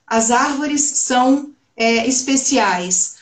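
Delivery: "As árvores são especiais" slow, with São Paulo S sounds; São Paulo accent. Shown as a demonstration. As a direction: say it slowly like a paulista, hissing S. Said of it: "se eu trouxer". (sotaque paulista, devagar)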